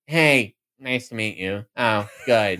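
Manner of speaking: lightly imitated stiff candidate; half-hearted, low-key meet and greet; mock an uncomfortable handshake greeting; awkward politician greeting. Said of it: "he would be like".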